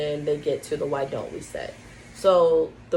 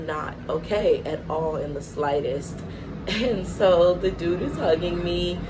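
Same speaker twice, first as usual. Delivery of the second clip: ordinary talk, nothing marked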